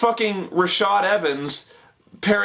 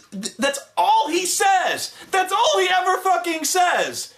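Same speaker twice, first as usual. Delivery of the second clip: high-pitched, drawn-out exasperation; fed-up, pitch shooting up on each line; exasperated rant; 11 semitones higher than usual. Real, not acted. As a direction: wail it in comic disbelief. Ranting at repetitive show host.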